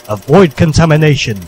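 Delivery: usual speaking voice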